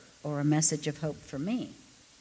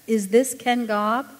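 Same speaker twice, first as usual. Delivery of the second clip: slow, louder, impatient question; less patiently; 6 semitones higher, 6 dB louder, slower than usual. Acted. Operator losing patience with Ken, slow and impatient. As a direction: press him, losing patience. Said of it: "Less patiently, the operator asked".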